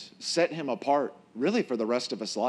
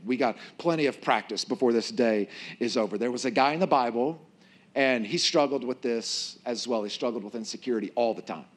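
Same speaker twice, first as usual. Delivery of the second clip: normal talking voice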